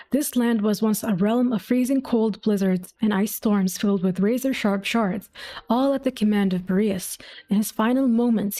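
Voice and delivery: ordinary talk, nothing marked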